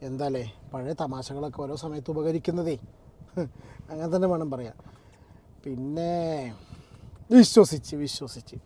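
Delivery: low, quiet mock-agreement; sarcastic disbelief. Real, not acted. Reacting to the film-joke excuse in the apology, sarcastic. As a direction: pretend to believe it, softly and drily.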